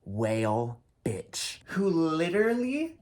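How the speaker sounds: slow, loud furious rant; slow outraged yelling about the crowd. Real, not acted. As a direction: hammer each word out in outrage. Ranting about drunk crowd member.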